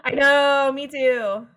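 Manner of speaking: loud, high fangirl outburst; excited, drawn-out gush; gushing agreement. Real, not acted. Gushing agreement about shipping them.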